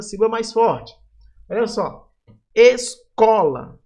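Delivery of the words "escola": "Escola" drawn out slowly, loud high CO; stress on CO. Shown as a demonstration. Teacher showing students the strongest syllable, stress on CO in "Escola". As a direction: stretch "Escola" loudly, leaning on CO. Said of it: "o có aqui vai ser a sílaba mais forte".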